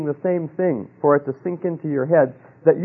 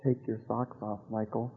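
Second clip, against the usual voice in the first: much softer, lower, near-hushed inner voice; still small voice; 5 semitones lower, 16 dB quieter than usual. Retold or imitated. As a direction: let it fall almost to nothing. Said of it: "Still small voice".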